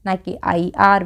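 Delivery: ordinary delivery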